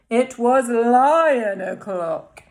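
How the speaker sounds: loud, slow and booming; slow grand declaration; big lion announcement. A narrator's reading. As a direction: boom it out like a lion's arrival.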